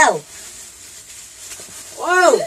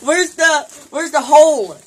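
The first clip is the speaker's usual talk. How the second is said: hole stressed clearly; corrected word; 3 semitones higher, 7 dB louder, slower than usual. Real, not acted. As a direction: say hole firmly to fix the slip. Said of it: "I did not mean to say that".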